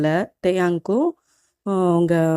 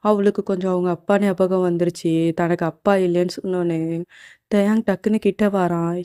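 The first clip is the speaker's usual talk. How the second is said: ordinary delivery, nothing marked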